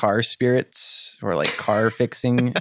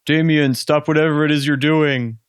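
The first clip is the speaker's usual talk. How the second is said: imitating Whitaker; 4 semitones higher, 5 dB louder than usual. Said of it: "You have to do it in a British accent".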